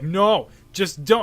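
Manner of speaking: loud, slow and firm refusal; group refusing the tank, loud and slow. Retold or imitated. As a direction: shut him down with a drawn-out no. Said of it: "And they're like".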